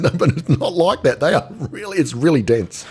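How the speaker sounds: high, insistent put-on voice; retold insistence. Husband correcting his wife at the movie, high insistent. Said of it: "I said".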